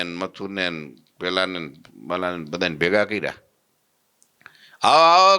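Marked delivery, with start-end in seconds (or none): 4.8-5.4 high, loud call across the yard. Retold or imitated. Host calls the neighbours to see the guru, loud call. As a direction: shout for the neighbours to come.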